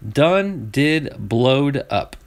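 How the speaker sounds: very slow, savoring each word in Southern drawl. Shown as a demonstration. Repeating the racer's phrase to viewers, slow Southern drawl. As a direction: stretch out "Done did blowed up" Southern. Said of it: "Tell me that ain't Southern".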